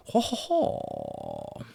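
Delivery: put-on very low, very slow Cajun-accented ho ho; Cajun chuckle. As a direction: drag out a deep Cajun laugh. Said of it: "the New Orleans accent is more fun to do".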